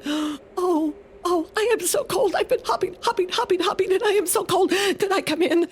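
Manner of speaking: very high, quick, cold little rabbit; a chilled rabbit pleads to share the mitten; shivering rabbit. Acted. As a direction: squeak it out like a freezing bunny. Cold rabbit begs entry.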